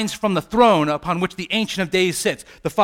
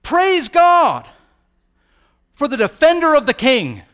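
loud, high triumphant shout; crying out in praise; 9 semitones higher, 8 dB louder than usual. Acted. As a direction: cry it out like all heaven cheering. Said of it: "they all cried with one voice".

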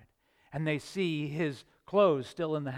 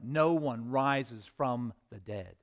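ordinary talk

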